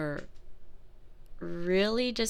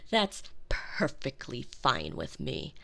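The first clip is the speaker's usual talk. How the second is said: normal talking voice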